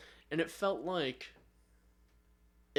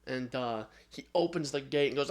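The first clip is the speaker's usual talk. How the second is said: ordinary talk, nothing marked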